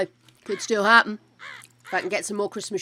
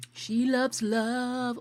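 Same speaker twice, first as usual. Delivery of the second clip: slow sing-song; 2 semitones higher, much slower than usual. Pretend. Sing-song remark on giddy sim.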